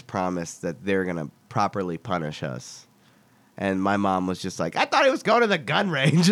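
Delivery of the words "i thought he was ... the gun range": high-pitched imitation of a dismayed mother; exasperated mom. Mother protesting to the cop who called, high-pitched mock dismay. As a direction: protest in a raised, put-on mom voice.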